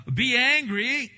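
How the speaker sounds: loud, high proclamation; preacher shouting Be angry; booming command. Acted. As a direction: thunder out the command.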